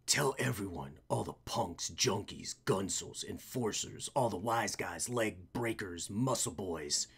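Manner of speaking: low, soft, menacing hero; low vigilante voice. Acted. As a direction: murmur threats like a dark avenger. Voicing the vigilante.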